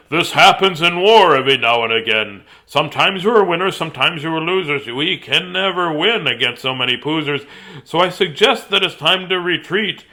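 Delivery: loud general's address; forceful, brisk rhythmic announcement; shouting to troops. A narrator's reading. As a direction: shout it out to your men. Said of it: "shouted out to his men".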